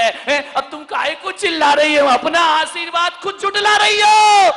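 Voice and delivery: cheeky reply rising from loud to a high shout, with a laugh; laughing tease that swells into a shout; mock retort building to a yell. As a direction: talk back to mother-in-law, ending in a yell. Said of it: "अम्मा चिल्लाए तो कहना".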